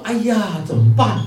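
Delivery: dismayed. Acted. Bamboo hears it will be hollowed out, dismayed. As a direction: exclaim in mock alarm as the bamboo.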